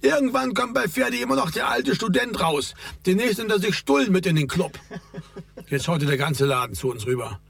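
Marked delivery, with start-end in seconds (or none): 0.0-4.8 higher-pitched call from the queue; lightly projected taunt across the room. A narrator's reading. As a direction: call it over to the table, no real shout. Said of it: "rief Raimund von seinem Platz in der Schlange herüber".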